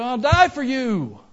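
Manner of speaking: acted fervent pledge, high and loud; zealous vow.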